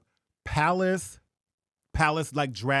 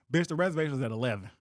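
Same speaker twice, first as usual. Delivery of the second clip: ordinary talk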